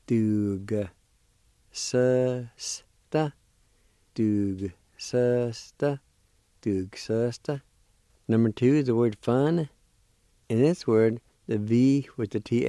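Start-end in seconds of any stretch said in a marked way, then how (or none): 0.1-7.6 "Dug-susta" said slowly, syllable by syllable; slow pronunciation demo. Shown as a demonstration. Teacher demonstrating the word for learners, drawn out. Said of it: "like this".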